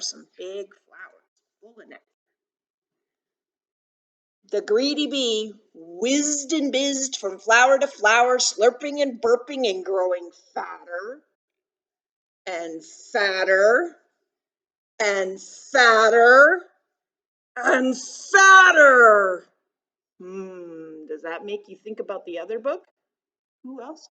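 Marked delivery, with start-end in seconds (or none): 4.5-11.2 put-on louder, emphatic storytelling to children; picture book read-aloud. A narrator's reading. 12.5-19.5 slow "fatter", louder each time; building swell. A narrator's reading. Story repetition swells with the bee.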